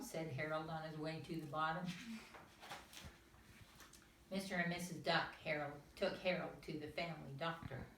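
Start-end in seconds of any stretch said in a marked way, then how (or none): none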